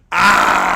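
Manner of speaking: loud, high exclamation of dismay; loud drawn-out scream. Real, not acted. Streamer hit with a hard wife question, a loud cry.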